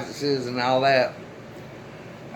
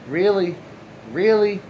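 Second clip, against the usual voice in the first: disbelief; 6 semitones higher than usual. Retold or imitated. Shocked at the bag.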